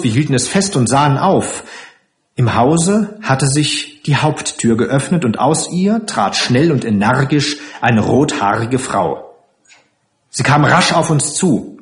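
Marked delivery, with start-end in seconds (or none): none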